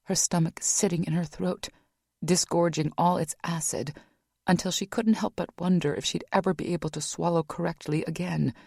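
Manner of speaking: ordinary delivery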